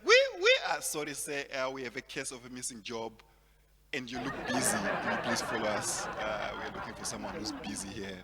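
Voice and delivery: lightly voiced officer arresting a suspect; a hint of a stern policeman; mock police officer. Police questioning a busy suspect.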